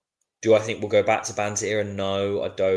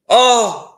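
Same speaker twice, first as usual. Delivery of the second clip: loud, high-pitched "Oh!"; real cry of alarm at the match; shocked yell; 13 dB louder than usual. Real, not acted. Reacts to near goal in match.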